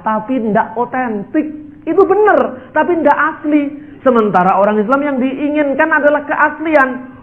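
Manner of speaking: ordinary talk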